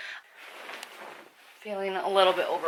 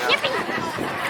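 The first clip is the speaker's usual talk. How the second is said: excited cheer. Real, not acted.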